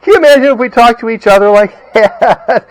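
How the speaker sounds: high and loud, laughing through it; amused, laughing. Real, not acted. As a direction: giggle the question out. Laughing at his dog talk.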